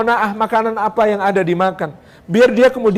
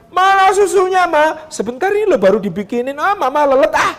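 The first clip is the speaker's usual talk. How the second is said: put-on higher demanding kid's whine; whiny child; 8 semitones higher than usual. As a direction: complain like a spoiled child. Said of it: "Anaknya bangun".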